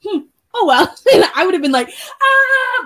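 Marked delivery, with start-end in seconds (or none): 0.1-0.9 nonchalant. Retold or imitated. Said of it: "kinda like". 2.2-2.8 high mock shriek of fright; hammed-up scared yelp; mock scream. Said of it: "screaming and freaking out".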